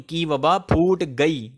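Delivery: की stressed, slow and clear on की वबा फूट गई. Shown as a demonstration. Teacher correcting students, slow stress on की. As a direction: stress की to show the feminine gender. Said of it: "का वबा नहीं".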